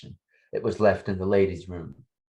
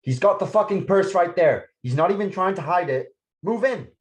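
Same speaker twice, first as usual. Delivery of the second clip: urgent, high, pressed orders to the team; tense cop order; 11 semitones higher, 3 dB louder than usual. Acted. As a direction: call the move on the suspect. Cop spotting suspect, ordering move.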